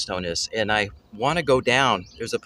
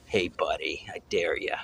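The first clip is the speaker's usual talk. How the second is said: low, soft taunting bison voice; voicing a bison; 7 semitones lower, 7 dB quieter than usual. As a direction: dare them like a glaring bison, low and quiet.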